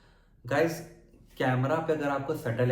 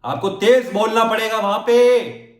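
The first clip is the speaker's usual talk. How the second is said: much higher and louder, projected shout; shouting for a distant audience; stage shout; 11 semitones higher, 13 dB louder, slower than usual. Shown as a demonstration. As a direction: throw it to the back row. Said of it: "चिल्लाना पड़ेगा थोड़ा सा".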